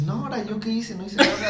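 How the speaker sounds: high, mock-innocent protest; playing dumb. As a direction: feign innocence, a little hammed. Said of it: "te haces pendejo y dices".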